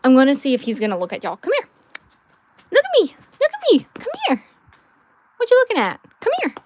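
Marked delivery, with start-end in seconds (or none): 1.4-6.5 high, sing-song voice calling the dog over; high coaxing dog talk. Real, not acted. Owner calls her dog over in high pet-talk voice.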